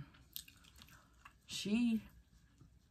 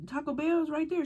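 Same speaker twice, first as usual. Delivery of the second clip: higher, puzzled voice; high surprised pointing out; 14 semitones higher than usual. Retold or imitated. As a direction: point it out like it's obvious. Pointing out nearby Taco Bell.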